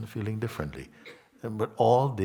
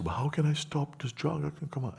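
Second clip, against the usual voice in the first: higher, pleading seeker's voice; 9 semitones higher than usual. Retold or imitated. Teacher imitating a seeker asking how to stop, higher and pleading. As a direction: lift into the voice of a desperate student.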